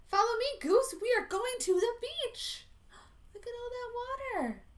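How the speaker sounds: eager duck calling back to his friend; excited duck. Acted. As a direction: race ahead, thrilled. Duck races to the beach.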